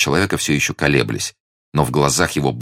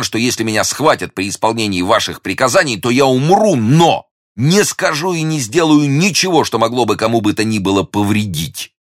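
fervent, high-pitched oath; ardent young hero; 8 semitones higher than usual. Acted. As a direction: swear it passionately, higher and more emphatic. Hero swears loyalty.

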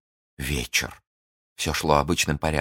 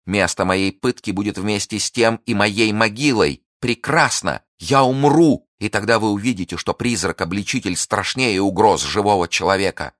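high, loud impassioned vow; fierce defiance; 5 semitones higher, 4 dB louder than usual. Acted. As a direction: hurl the vow at him.

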